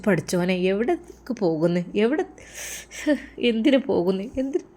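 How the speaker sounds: hushed, troubled thinking aloud; worried inner thought. Acted. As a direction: murmur it as a mother's anxious thought. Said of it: "ഉമ്മ വിചാരിച്ചു".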